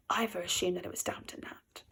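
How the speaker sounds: ordinary delivery, nothing marked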